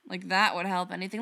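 ordinary delivery

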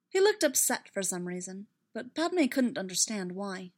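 ordinary delivery

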